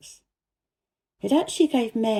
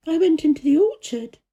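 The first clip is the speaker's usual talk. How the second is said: put-on lightly higher girl's voice, earnest; a girl telling the gardener about the secret garden, higher voiced; young Mary voice; 8 semitones higher, 3 dB louder than usual. A narrator's reading. Mary's voice in the story.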